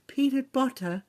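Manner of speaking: practiced imitation. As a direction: imitate her sultry catchphrase. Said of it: "I was practicing".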